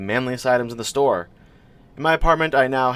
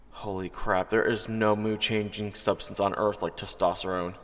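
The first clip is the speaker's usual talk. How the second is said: much softer, confiding aside; quiet aside; 3 semitones lower, 9 dB quieter than usual. A narrator's reading. Narrator confiding to listeners, much softer. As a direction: let it slip under your breath.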